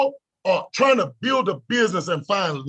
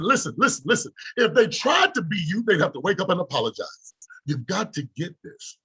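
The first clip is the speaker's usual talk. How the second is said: ordinary talk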